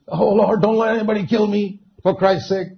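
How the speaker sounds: high-pitched imitation of a plea; mock pleading prayer. Preacher acting a prayer he never prayed, mock pleading. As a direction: plead as if praying, half-acted.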